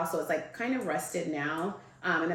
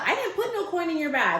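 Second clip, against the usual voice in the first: high, loud mock indignation; mom's indignant denial; 11 semitones higher, 5 dB louder than usual. Mom denies putting coin in bag, indignant. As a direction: imitate mom protesting, pitched up and loud.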